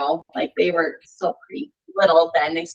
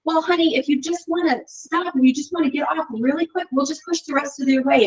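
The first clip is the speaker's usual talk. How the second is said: gentle mom; 8 semitones higher than usual. Retold or imitated. Mom to her tired son, gentle and reassuring. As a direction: reassure him tenderly. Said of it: "I said".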